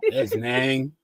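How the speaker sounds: lightly imitated thick Southern drawl; garbled Southern twang, mildly imitated; mock southern accent. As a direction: mimic a hard-to-catch Southern accent. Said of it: "down south accent was thick".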